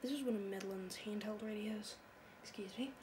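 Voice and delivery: normal talking voice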